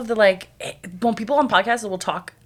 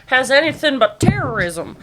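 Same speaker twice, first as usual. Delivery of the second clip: higher, slower mock pompous voice; mock pompous quote; 6 semitones higher, 5 dB louder, slower than usual. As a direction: lampoon their smug tone slowly. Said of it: "they're like".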